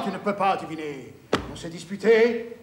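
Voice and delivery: usual speaking voice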